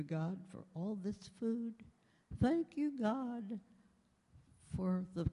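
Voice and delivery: put-on high, slow little toddler voice; sing-song small child saying grace; baby voice. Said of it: "in this baby voice".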